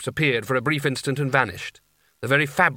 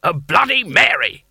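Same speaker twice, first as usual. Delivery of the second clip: loud, higher war cry of an order; warlike drinks order; 5 semitones higher, 7 dB louder than usual. A narrator's reading. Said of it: "sound like the opening of hostilities".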